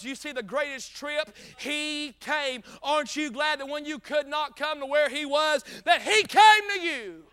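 loud preaching building to a high shout; full-force preaching. Acted. Preacher telling the congregation he came to you, full-force preaching. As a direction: drive each phrase harder to the climax.

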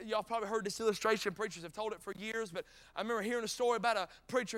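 normal talking voice

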